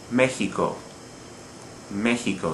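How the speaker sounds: MExico, weight on ME; third-to-last syllable stress. Shown as a demonstration. Teacher showing the same pattern, Mexico stressed on ME. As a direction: say "Mexico" the Spanish way, MEH-hee-ko, stressed at the start. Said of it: "but the third to last".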